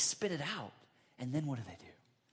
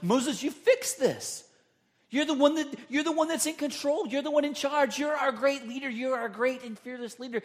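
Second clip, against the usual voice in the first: louder, higher needy complaint; whiny demanding crowd; 9 semitones higher, 6 dB louder than usual. Retold or imitated. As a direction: flatter Moses while demanding he fix it. Grumbling people voiced to Moses.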